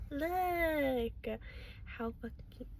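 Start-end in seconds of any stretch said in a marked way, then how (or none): 1.8-2.7 hushed. Real, not acted. Hushed gushing over the figure.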